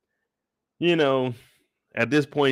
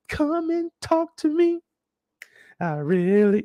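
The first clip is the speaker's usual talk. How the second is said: flirty vendor; 14 semitones higher, slower than usual. Retold or imitated. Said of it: "them men".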